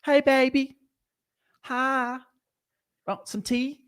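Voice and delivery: high-pitched flirty Southern belle impression; Southern accent.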